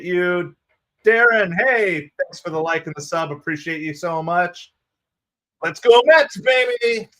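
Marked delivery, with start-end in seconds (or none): 5.6-7.1 loud, high fan cheer; team cheer. Real, not acted. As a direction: whoop it for the home team.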